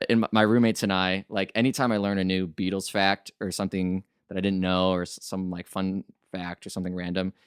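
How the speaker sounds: normal talking voice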